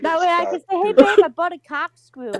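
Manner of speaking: giggling as she tells it; laughing. Real, not acted. Laughing while picturing telling boyfriend.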